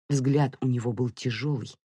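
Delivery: usual speaking voice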